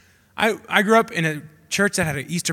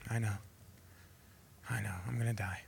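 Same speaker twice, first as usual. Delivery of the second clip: quiet acceptance; 10 semitones lower, 19 dB quieter, faster than usual. Retold or imitated. Jesus calmly accepting death.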